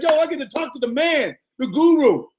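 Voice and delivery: super excited. Retold or imitated. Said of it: "I was super excited".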